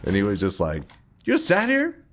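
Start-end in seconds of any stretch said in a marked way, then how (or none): 1.2-2.0 very high put-on dad voice; hammed-up disbelieving dad; baffled dad. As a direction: squeak it like a stunned father. Dad voiced in retold story.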